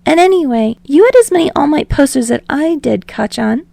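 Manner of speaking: rambling. Acted. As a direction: babble on nervously without a breath.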